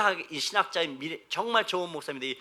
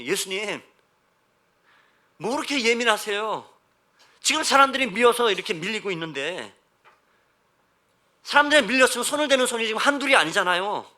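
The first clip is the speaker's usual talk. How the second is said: mildly exasperated protest to the master; baffled disciples; 5 semitones higher, 7 dB louder than usual. Acted. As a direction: object as if it makes no sense. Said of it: "황당한 표정". (황당하다는 듯 따지는 제자들)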